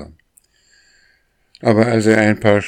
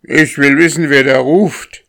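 high, loud demanding shout; insistent storybook yell, much louder; 10 semitones higher, 10 dB louder than usual. A narrator's reading. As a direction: yell up at an unseen caller. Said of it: "schrie der Mensch".

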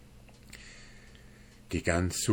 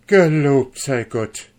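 loud, higher cry of thanks; exclaiming; 6 semitones higher, 9 dB louder than usual. A narrator's reading. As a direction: burst out at last, loud and high. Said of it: "rief er endlich aus".

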